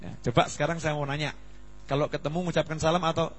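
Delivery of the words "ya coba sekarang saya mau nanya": ordinary delivery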